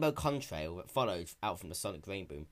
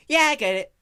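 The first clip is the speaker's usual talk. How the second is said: raised, brash tomboy pony voice; lightly raised young heroine; Rainbow Dash voice; 14 dB louder than usual. Acted. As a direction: play her sporty and cocky.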